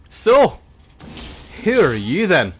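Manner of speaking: loud, high challenging question; imitating a bold fencer. Edinburgh fencing chap confronting him in Malta pub, loud. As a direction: demand his name, brashly. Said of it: "just comes up to me".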